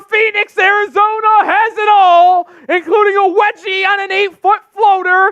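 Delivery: sustained screaming play-by-play, very high and loud; going nuclear. Real, not acted. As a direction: erupt like it's the greatest play ever. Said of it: "I go nuclear when we get a wedgie".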